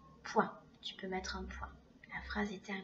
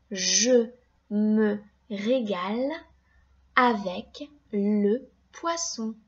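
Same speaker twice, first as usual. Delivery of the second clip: slow, louder, word-by-word reread; 6 dB louder, slower than usual. Shown as a demonstration. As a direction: read the sentence back slowly for checking. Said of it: "je relis la phrase".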